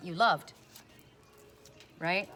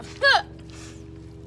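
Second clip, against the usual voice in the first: airy laugh far above her voice; amused laugh after the quote; breathy high laugh; 6 dB louder than usual. Real, not acted. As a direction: laugh at the refusal.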